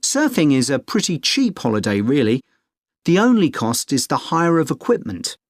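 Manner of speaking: ordinary talk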